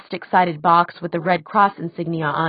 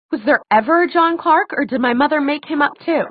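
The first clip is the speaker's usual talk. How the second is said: high, louder accusing questions; voice raised; 9 semitones higher, 4 dB louder than usual. A narrator's reading. Daughter doubts her mother's story, voice raised. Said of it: "Clary's voice rose".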